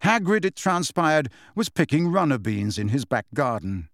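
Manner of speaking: ordinary delivery, nothing marked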